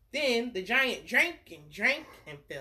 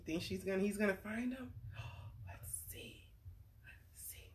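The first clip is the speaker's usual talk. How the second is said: hushed suspense whisper; 12 dB quieter than usual. Acted. Whispering to the little friends, hushed suspense. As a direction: breathe it like a secret.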